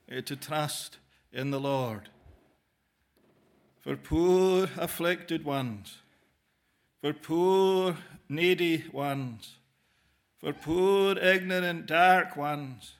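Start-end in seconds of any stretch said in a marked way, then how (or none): 3.8-12.9 slow pleading appeal. Acted. Preacher appeals to the afflicted.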